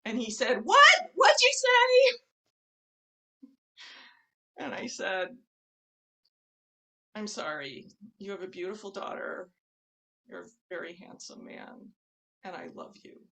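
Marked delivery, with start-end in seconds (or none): 0.6-2.2 very loud, high-pitched mock yell; incredulous, blaring acted outburst; retold outraged shout. Screaming man's startled reply. 7.1-13.2 very soft, sinking almost to nothing; meek repeated apology. Retold or imitated. Repeats soft apology after his shout.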